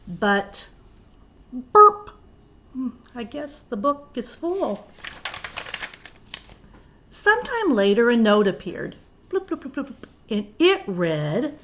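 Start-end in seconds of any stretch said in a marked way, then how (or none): 1.5-2.1 a big high burp sound from the book; loud burp. Acted. The book burps. 9.3-10.1 popping sound effect. Acted.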